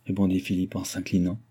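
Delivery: usual speaking voice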